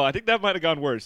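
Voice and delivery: usual speaking voice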